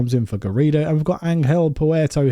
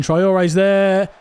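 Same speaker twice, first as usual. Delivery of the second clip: excited call; 6 semitones higher, 4 dB louder than usual. Real, not acted. Calling a goal chance.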